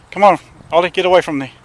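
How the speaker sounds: loud, high call to the dog. Real, not acted. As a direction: shout across to get the dog moving. Calling the dog away.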